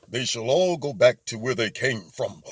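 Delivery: low, ominous villain voice; Darth Vader. Acted. Politician gets up there as supervillain, deep menacing decree. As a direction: pronounce it like a menacing dark overlord, deep. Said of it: "President Trump is Darth Vader".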